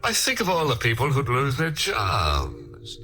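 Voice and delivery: ordinary talk, nothing marked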